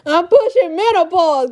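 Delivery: exaggerated repeat. Real, not acted. Laughing repeat of the age joke.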